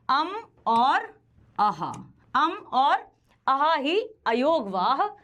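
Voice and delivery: अम and अह stretched very slowly; slow drill. Shown as a demonstration. Teacher drilling students on अम and अह, very slow. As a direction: draw out अम and अह syllable by syllable. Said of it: "teacher drills अम अह".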